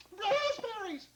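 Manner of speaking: very high, drawn-out exclamation; ecstatic cry. Acted. Wife tries the marvelous fruit, ecstatic cry. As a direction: sing out the word in amazed joy. Ecstatic taste, unlike grocer's reply.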